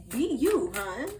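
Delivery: pushy. Real, not acted. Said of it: "I'm getting so aggressive with it".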